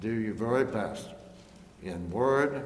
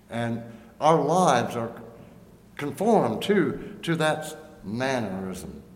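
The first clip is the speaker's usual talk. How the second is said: preaching; 3 semitones higher, 5 dB louder, slower than usual. Acted. Preacher building on conformity.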